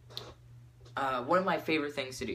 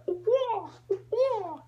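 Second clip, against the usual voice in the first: loud, high repeated bird squawk; bird call; 4 dB louder than usual. Retold or imitated. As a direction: squawk out two bird cries. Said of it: "I would make bird sounds".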